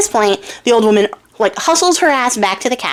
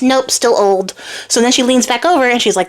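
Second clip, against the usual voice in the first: ordinary talk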